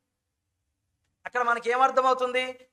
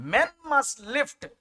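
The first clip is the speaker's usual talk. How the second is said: drawn out; 5 semitones higher, 4 dB louder, much slower than usual. Shown as a demonstration. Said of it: "drawn out key phrase".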